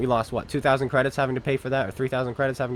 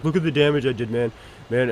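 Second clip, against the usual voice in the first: mock bragging player.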